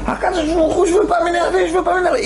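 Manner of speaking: desperate shout of prayer; crying out. Retold or imitated. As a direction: break out into a cry to God. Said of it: "Et il crie".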